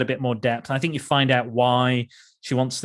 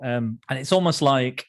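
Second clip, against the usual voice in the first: ordinary delivery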